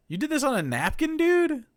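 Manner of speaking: normal talking voice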